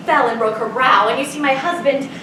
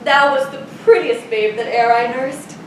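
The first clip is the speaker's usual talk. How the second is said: ordinary talk, nothing marked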